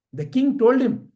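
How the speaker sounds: usual speaking voice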